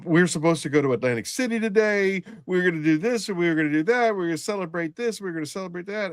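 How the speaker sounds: high-pitched mock wailing, without real tears; imitated sobbing lament. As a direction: imitate a grieving man pouring out his plans. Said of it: "he's sobbing on me".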